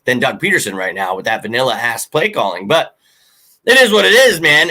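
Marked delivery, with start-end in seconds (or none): 3.7-4.7 exasperated shout. Real, not acted. Resigned outburst about team.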